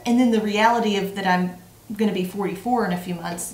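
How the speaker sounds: ordinary talk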